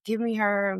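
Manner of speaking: plain reading voice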